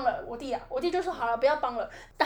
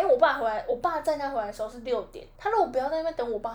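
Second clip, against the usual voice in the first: normal talking voice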